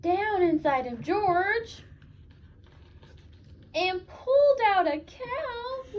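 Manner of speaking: slow, rising to a high loud reveal; slow climb to a loud high cow; building excitement. Acted. Climax of story.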